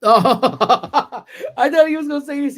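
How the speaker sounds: laughing through words. Real, not acted. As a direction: crack up while saying it.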